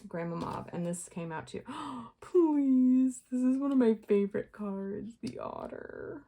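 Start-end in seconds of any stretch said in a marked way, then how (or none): 1.7-2.2 gasp. Real, not acted. Favorite card appears. 2.2-6.2 higher, thrilled, trailing to breathy hush; delighted. Real, not acted. Delighted by favorite card.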